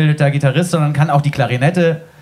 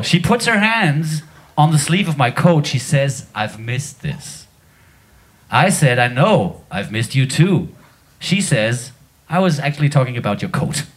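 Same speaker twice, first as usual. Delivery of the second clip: recited lyric; 3 semitones lower than usual. Shown as a demonstration. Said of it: "darf ich den dann trotzdem vortragen".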